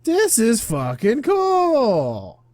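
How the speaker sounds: high, loud, drawn-out whoop; thrilled shout. Acted. Player reacts to the spirit lore, thrilled shout. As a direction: stretch the words out in loud delight.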